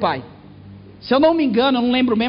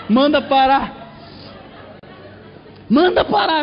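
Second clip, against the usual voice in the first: begging mother; 3 semitones higher, slower than usual. Retold or imitated. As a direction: plead to stop the plane, shrieking.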